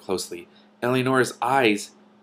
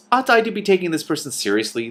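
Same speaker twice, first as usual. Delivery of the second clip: raised, questioning doubt; doubtful tone, pitched up; 8 semitones higher, 4 dB louder than usual. A narrator's reading. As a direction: sound unsure he should take this seriously. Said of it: "in a rather doubtful tone".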